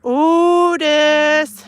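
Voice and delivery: long high loud call across the yard; yelling for the dog. Real, not acted. Calling the dog again.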